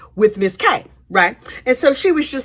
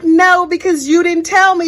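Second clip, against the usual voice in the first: higher, louder indignant retort; mock indignant reply; 5 semitones higher, 4 dB louder than usual. Kay firing back at Lori on the stand, indignant. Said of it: "Kay was like".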